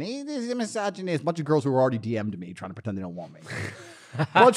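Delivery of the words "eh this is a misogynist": high, softer mimicked whine; mock whining critic. Mimicking chicks who call him misogynist, mock whine. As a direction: imitate complainers in a small high voice. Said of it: "a bunch of chicks saying".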